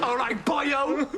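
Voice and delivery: put-on Cardiff accent; Welsh accent. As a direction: pretend to be Welsh, slowly. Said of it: "it's the same in Pakistani accent".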